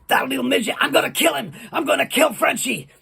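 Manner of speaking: high-pitched, irate threat; hammed-up angry wrestler voice; fired-up wrestler. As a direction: vow revenge in a worked-up, put-on voice. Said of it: "he was all fired up".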